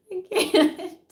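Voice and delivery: can't get it out for laughing. Real, not acted. Cracking up at her own joke.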